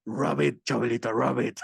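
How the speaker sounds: low tempting man's voice; Chabelita mimicking her tempter. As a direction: drop low and coax, as an imitated seducer. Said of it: "she would make that voice herself".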